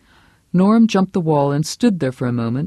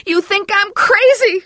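much higher, louder wail; shouting; 5 dB louder than usual. Acted. Vera shouting at Herb before breaking down. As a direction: hurl it at him in despair. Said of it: "she shouted at him".

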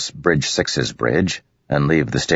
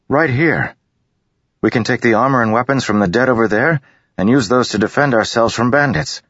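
eager persuasion; 9 semitones higher, 3 dB louder than usual. A narrator's reading. Kaladin persuading the crew.

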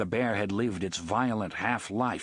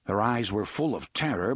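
plain reading voice

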